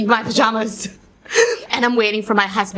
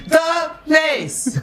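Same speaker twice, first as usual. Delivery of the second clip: high, hammed-up "The place", drawn out; Lady Macbeth; 7 semitones higher than usual. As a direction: belt the phrase like an overdone stage queen. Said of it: "I cannot do that as Lady Macbeth".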